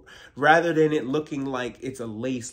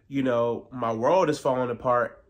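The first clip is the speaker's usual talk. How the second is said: usual speaking voice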